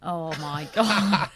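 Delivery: slow laughing oh my God; laughing disbelief. Real, not acted. Host laughing at her co-host not knowing the show. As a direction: dissolve into laughter.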